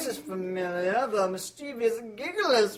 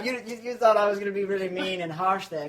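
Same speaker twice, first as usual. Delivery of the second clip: usual speaking voice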